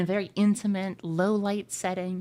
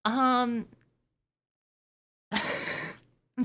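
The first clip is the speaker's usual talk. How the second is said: cracking up mid-line; 4 semitones higher, much slower than usual. Real, not acted. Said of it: "I'm laughing at my own fucking jokes now".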